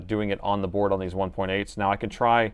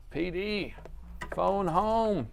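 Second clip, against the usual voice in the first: pitched-up alien croak, a few semitones high; mock alien voice, slightly raised; E.T. impression; 9 semitones higher, 5 dB quieter, much slower than usual. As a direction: play the little alien who wants to go home. E.T. catchphrase imitation.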